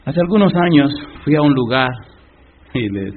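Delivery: ordinary delivery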